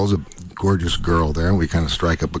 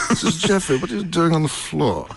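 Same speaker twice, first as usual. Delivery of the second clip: higher-pitched impression of an English actor; James Mason; 8 semitones higher than usual. Actor finds his sick colleague on the floor, impersonated. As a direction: imitate a concerned, refined screen star. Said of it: "who's standing there but James Mason".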